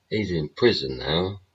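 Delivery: usual speaking voice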